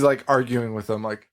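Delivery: ordinary delivery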